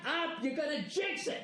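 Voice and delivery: high, strained, hushed fan voice; superstitious fan begging him to stop talking; jittery Cleveland fan. Retold or imitated. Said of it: "Everybody in Cleveland right now is going".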